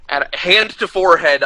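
ordinary delivery

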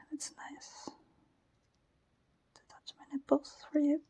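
ordinary delivery

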